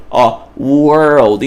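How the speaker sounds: world with a clear R-L; World, slow and clear; R-L world demo; careful world. Shown as a demonstration. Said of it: "eu escrevo exatamente a forma".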